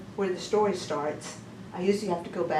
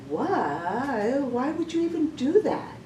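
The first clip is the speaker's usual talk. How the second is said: drawn-out why, then pitched up; baffled disbelief; 5 semitones higher, 3 dB louder, slower than usual. Acted. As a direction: act out an incredulous reaction, voice climbing. Acting out baffled disbelief at someone.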